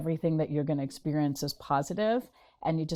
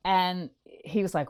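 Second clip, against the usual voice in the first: ordinary talk, nothing marked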